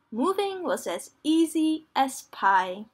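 usual speaking voice

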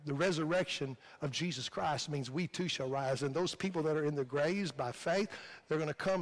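ordinary delivery

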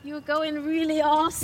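excited praise. Real, not acted. Mom praising child's riding.

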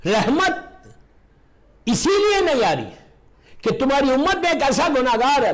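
high thundering voice of God; booming divine voice. Acted. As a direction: proclaim it from above, loud. Voice of Allah in sermon.

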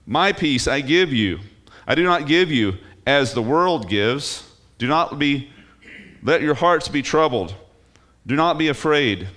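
measured, emphatic Bible quotation; emphatic scripture reading. Acted. Reads scripture aloud emphatically.

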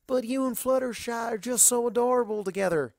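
high, gushing and doting; high doting gush about two friends together; gushing matchmaker. Acted. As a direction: coo over the cute couple. Applejack gushing.

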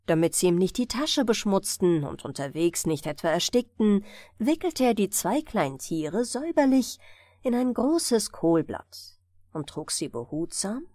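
usual speaking voice

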